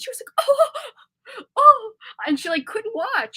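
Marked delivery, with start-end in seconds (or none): 0.5-1.9 high, loud mock gasp of "oh"; imitated shocked gasp. Daughter imitating mom at violent movie scenes, mock shocked gasp. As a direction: gasp "oh" like someone appalled by violence. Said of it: "she would literally gasp out loud".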